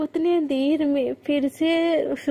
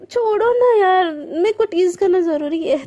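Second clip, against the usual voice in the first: playful protest; 4 semitones higher, 5 dB louder than usual. Acted.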